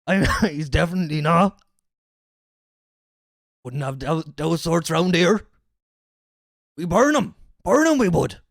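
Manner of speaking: loud, hammed-up West Country local; mock bigoted yokel. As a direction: play an indignant small-minded villager for laughs.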